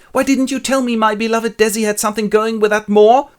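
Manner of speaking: loud, high-pitched indignant whine; hammed-up outrage of a jilted suitor, very high; indignant complaint. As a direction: complain loudly in a comic high voice.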